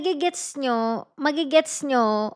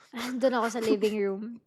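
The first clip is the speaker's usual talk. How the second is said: usual speaking voice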